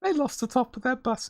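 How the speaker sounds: mock the breathless clip narrator; TikTok narrator voice. Vlogger mocking viral bus-crash clips, hammed-up narrator voice. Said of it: "in that voice".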